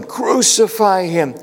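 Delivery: high, slow mock crowd yell. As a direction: call it out like the mob demanding his death. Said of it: "they heard the crowds yelling".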